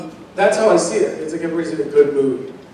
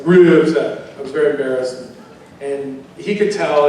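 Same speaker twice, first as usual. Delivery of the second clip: ordinary talk, nothing marked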